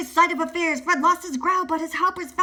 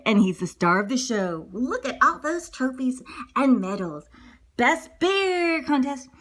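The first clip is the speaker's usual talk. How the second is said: usual speaking voice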